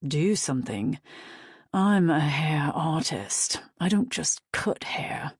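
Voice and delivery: lightly voiced British hairdresser, breezy; a cocky stylist selling himself, light British lilt. A narrator's reading. Stylist reassuring Daisy, lightly British.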